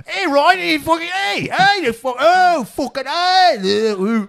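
put-on loud, high garbled British accent, slowing at the end; mock British accent. To a friend, mocking thick British show accent in shouted gibberish. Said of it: "all you hear is".

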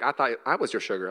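low, playful tease of her husband; teasing wife voice. Retold or imitated. Wife teasing husband over text, low playful flirt. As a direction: purr it like a flirty jab. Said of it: "And Rachel's like".

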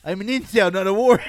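loud, high-pitched mobster protest; punchy, hammed-up tough-guy voice; Tony Soprano. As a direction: bluster it out like a mock mob boss. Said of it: "It's hard for me to do like Tony".

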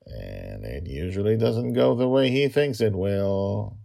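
usual speaking voice

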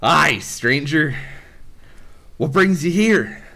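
a hint of a wary dwarf hailing travellers; dwarf greeting. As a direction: greet the stranger as a gruff-ish dwarf. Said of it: "one of the dwarfs looks at you and goes".

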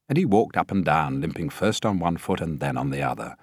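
usual speaking voice

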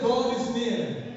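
emphatic declaration. Shown as a demonstration. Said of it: "Have I, have I lost my people?".

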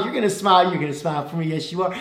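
light sing-song coaxing, lightly voiced; baby talk. Pastor coaxing a baby to smile, light baby talk. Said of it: "I'm gonna get the baby to smile".

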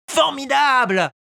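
high, loud cry of joy; joyful shout. A narrator's reading. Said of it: "Hurla Pinocchio". (Pinocchio crie de joie)

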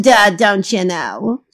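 hammed-up fake British accent; put-on British accent. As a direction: welcome listeners in a badly faked British accent. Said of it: "adopting a foreign accent".

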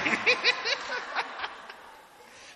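high, loud mock wail; high-pitched imitation sobbing; imitated crying. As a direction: act out a long crying fit. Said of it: "then she continued crying".

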